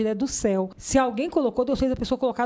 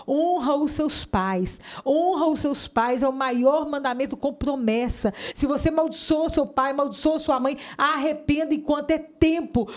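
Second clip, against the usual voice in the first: raised, emphatic warning; preaching exhortation; 4 semitones higher than usual. Real, not acted. Woman urging young listeners to honour parents, preaching. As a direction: press each command home like a preacher.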